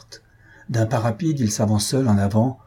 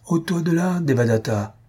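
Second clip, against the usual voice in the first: high commanding shout to step aside; mob shout; 7 semitones higher than usual. Acted. Brahmans order Devadatta away from the priest, high shout. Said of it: "s'écrièrent à leur tour les brahmanes".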